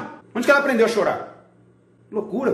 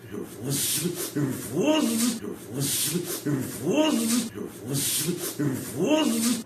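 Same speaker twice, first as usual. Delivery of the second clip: repeated swooping shudder, quiet then rising; put-on shivery nervous stammer; mock nervous trembling; 3 semitones lower, 6 dB quieter, much slower than usual. Shown as a demonstration. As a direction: act out nervous shakes in a looping noise. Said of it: "você começa a tremer assim".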